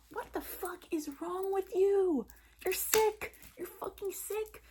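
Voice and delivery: high, drawn-out cry of outrage; outraged rebuke. Acted. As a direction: let him have it, high-pitched and appalled. Acted outrage at Evan after the news.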